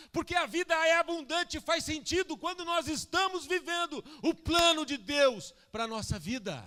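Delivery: loud, high, driving sermon voice; full-force preaching. Acted. Preacher urging the church to live God's plan, full-force preaching. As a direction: proclaim it loudly to the whole church.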